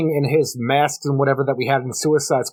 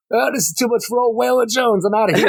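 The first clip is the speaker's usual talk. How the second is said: mock Killer Croc; 9 semitones higher than usual. Croc bails out of the shack.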